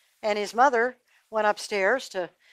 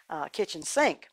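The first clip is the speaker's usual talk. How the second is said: ordinary delivery, nothing marked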